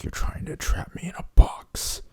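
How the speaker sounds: high, breathless whispered paranoia; a retold frightened whisper; paranoid whisper. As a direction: hiss it like he's cornered. Rand paranoid at being trapped.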